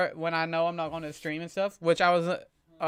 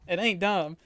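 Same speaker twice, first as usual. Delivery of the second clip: ordinary talk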